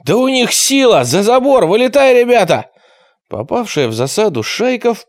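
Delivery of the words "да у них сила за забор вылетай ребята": high, loud shouts of alarm; yelling. A narrator's reading. Gang leader ordering his boys to flee, yelling. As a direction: yell the gang back over the fence. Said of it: "заорал Квакин".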